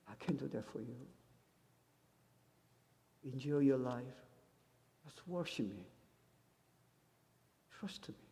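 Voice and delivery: put-on God gently comforting his child; soft voice of God. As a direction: speak as God, hushed and slow. Voicing God tenderly.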